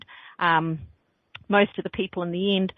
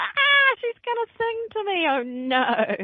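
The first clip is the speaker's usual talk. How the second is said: mock caller dread; 14 semitones higher, slower than usual. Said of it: "It's like".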